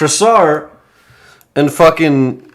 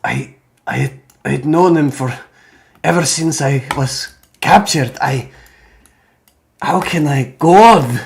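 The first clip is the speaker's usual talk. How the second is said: grieving warrior. Acted. Game master plays grieving Krasar.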